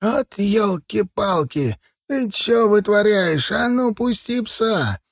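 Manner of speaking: put-on high, slow, scolding old watchman; elderly character pitched well up; old man's voice. A narrator's reading. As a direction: scold like an indignant old man, stretching words. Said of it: "раздался старческий голос".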